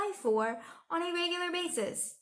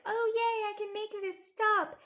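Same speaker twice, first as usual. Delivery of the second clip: high, gleeful imitation; mock excitement; 8 semitones higher than usual. Imitating Conway finding out the editor, mock excited. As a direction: squeal it in exaggerated, mocking delight. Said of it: "she gets all excited".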